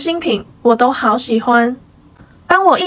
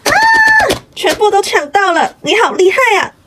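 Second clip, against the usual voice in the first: excited; 7 semitones higher, 4 dB louder, faster than usual. Acted. Grabbed every item in sale.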